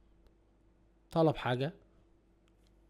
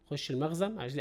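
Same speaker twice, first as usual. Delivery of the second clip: ordinary talk, nothing marked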